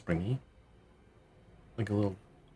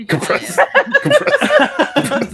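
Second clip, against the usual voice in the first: "Compressed" squeaked high through giggles; laughing; much slower than usual. Shown as a demonstration. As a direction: repeat "Compressed" with rising laughter.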